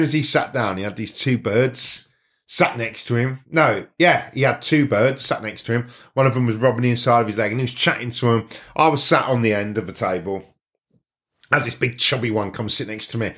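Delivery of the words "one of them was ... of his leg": normal talking voice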